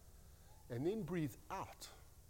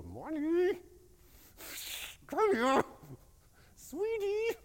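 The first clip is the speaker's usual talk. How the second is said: strained sweet call; 13 semitones higher, 4 dB louder, slower than usual. Retold or imitated. Owner calling the runaway dog inside, high forced sweetness. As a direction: voice pitched way up, sugary and tense. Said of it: "the more my voice goes up".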